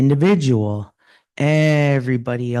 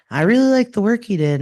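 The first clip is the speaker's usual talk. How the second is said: high, fast cheerful praise; a boss happily praising; mock boss on the phone; 9 semitones higher, faster than usual.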